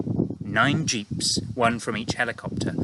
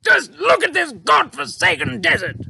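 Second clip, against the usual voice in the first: high, loud dismayed cry; cried; 8 dB louder than usual. A narrator's reading. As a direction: Air Force chief crying out at the desert, dismayed. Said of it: "he cried".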